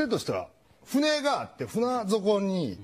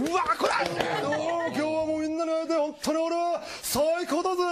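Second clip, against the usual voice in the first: put-on high, excited turtle voice calling out to the kids; a cocky, cheerful cartoon turtle, much higher; hyped-up sea turtle greeting fans; 7 semitones higher than usual.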